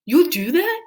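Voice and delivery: high, bright hopeful ask; hopeful dragon. A narrator's reading. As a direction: lift with hope. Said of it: "Dragon brightened with hope".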